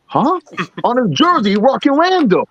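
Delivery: high-pitched incredulous echo; retold bafflement. Man retelling his reply to a friend's mix-up, baffled.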